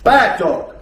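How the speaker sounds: loud, firm scolding bad dog. Shown as a demonstration. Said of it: "using a bad dog tone".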